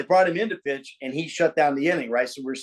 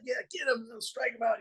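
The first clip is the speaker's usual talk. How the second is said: cheering; 10 semitones higher, 9 dB quieter than usual. Retold or imitated. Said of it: "I was like".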